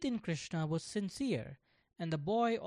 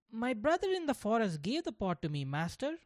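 lightly voiced young boy, higher; a hint of an earnest little boy speaking up; a child's voice; 6 semitones higher than usual. Voicing young Gopal.

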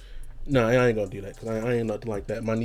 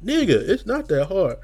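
louder, higher, fed-up snap back; exasperated retort; 8 semitones higher, 5 dB louder than usual. Retold or imitated. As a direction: tell him it's easy, exasperated. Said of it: "And I'm like".

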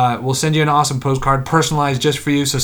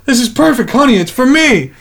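higher-pitched mock-delighted customer; put-on character voice; 10 semitones higher, 5 dB louder than usual. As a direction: play a pleased spouse, pitched well up. Voices someone in a joke.

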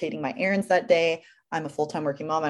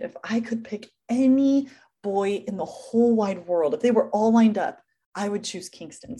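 heartfelt prayer; 4 semitones higher than usual. Retold or imitated. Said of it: "I come in and I'm like".

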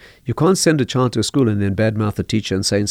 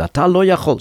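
a hint of a stern teacher, higher; stern Hebrew; 8 semitones higher than usual. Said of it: "in a very stern voice".

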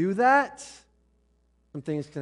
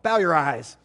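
sudden loud, raised order; mock command; 2 semitones higher, 9 dB louder than usual. Preacher corrects himself before leading prayer, mock-commanding shout. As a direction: boom it out as a playful order. Playfully orders congregation to pray.

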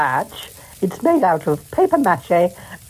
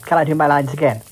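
ordinary talk

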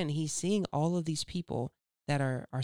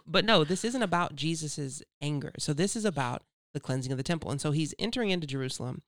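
ordinary talk, nothing marked

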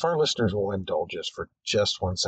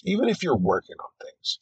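normal talking voice

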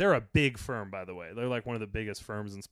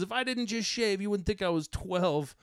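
lightly high mock of a kid; a higher whiny kid voice, held lightly; whiny young heckler; 8 semitones higher, 4 dB louder than usual. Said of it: "he goes".

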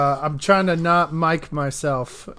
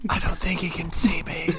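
whispering; 6 dB quieter than usual. Pretend. Hiding joke, unvoiced aside.

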